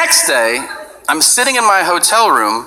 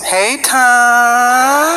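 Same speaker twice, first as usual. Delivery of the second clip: Tyson greeting; 7 semitones higher than usual. Retold or imitated. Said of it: "the phone rings".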